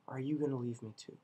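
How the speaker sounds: quiet, hurt question; a hint of pleading under the breath; soft plea. Jesus softly asking the twelve if they'll leave too.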